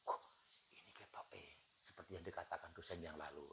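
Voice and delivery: hushed murmur to yourself; unvoiced whisper under the breath; whispered aside. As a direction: whisper it like a side comment in class. Student quietly interprets the lecture.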